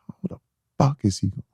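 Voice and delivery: soft, voiceless whispered disbelief; whispered cursing. Retold or imitated. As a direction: hiss it under your breath after hanging up. Whispered fury after hanging up.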